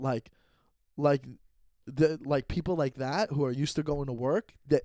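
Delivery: ordinary talk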